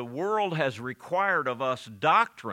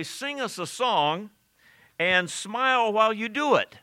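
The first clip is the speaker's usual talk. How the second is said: mocking captors; 8 semitones higher, 5 dB louder than usual.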